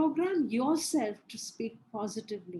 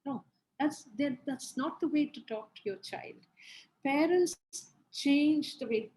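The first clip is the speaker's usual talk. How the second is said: ordinary delivery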